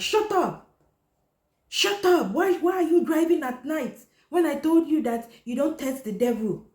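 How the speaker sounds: high, loud shrieking mother's yell; screeching angry mom. Retold or imitated. Mother yelling at daughter over the crashed car, high furious shriek. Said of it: "She's like".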